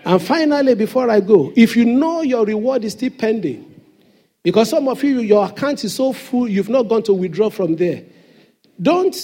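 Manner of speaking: ordinary talk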